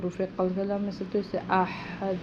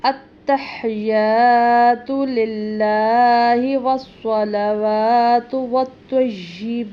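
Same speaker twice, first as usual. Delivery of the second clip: slow, much louder chanted tashahhud; prayer recitation; 3 semitones higher, 11 dB louder, much slower than usual. Shown as a demonstration. Teacher demonstrating tashahhud, slow louder chant. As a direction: recite it slowly and fully.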